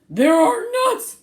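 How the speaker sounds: high, loud exclamation; exclaimed. Acted. Bruce exclaiming as the bird takes the nuts. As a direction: cry out in alarm. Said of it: "exclaimed Bruce".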